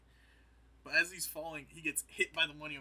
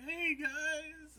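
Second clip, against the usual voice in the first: high, loud, drawn-out hello; fake-innocent greeting; 14 semitones higher, 7 dB louder than usual. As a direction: greet a crowd as if caught red-handed. Caught with body, greets staring Ewoks.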